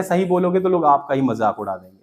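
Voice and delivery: usual speaking voice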